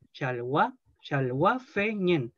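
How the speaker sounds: chalwa fe ñen spoken slowly, each part apart; slow model pronunciation. Shown as a demonstration. Teacher models chalwa fe ñen slowly for the class. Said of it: "el acento que se le pone".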